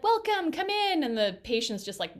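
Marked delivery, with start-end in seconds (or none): 0.0-1.0 high, loud singsong greeting; cheery mock welcome. Dentist's staff greeting a reluctant patient, high cheery welcome. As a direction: imitate an over-bright receptionist's welcome.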